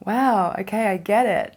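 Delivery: acted moment of it all clicking; impressed, loud realisation; dawning realisation. Acts out sudden understanding.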